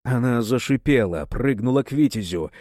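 plain reading voice

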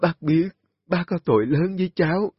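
anguished apology, high and loud. Acted. Old woman anguished, apologizing to the young mother. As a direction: plead for forgiveness in anguish. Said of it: "bà vật vã cúi đầu".

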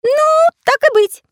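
very high, slow, loud agreement; conceding little artist. A narrator's reading. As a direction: give in with a high drawn-out voice. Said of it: "согласился Карандаш".

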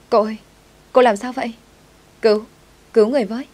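loud, high cry for help; panicked shouting. Acted. Maid finds Lucy unconscious and shouts for help, panicked. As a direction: call out in alarm, pitch jumping up. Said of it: "Có chuyện gì mà mày hét to vậy".

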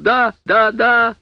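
high, loud panicked shrieks; shrieking. Acted. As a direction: shriek yes in terror. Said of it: "завизжал Сэм".

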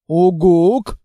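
loud odd rising hoot in a strange voice; creature hoot. Acted. Monster hoots a long question to the girl.

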